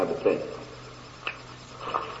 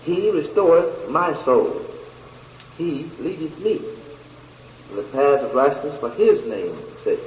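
deliberate, spaced phrases with long pauses; slower scripture reading. Shown as a demonstration. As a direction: read it slowly, phrase by phrase.

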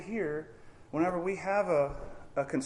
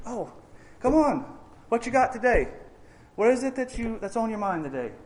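eager welcome; 7 semitones higher than usual. Retold or imitated. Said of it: "it's God looks and".

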